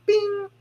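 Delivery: high, ringing "bing"; imitated elevator chime. Storyteller mimicking the elevator arriving, imitated chime. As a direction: mimic a lift's ding. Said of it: "the elevator goes".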